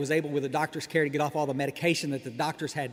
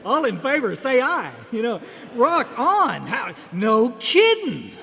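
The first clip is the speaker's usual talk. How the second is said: mock vote; 5 semitones higher, 6 dB louder, slower than usual. Real, not acted. Mocks the lady's sleep cure.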